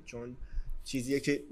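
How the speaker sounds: normal talking voice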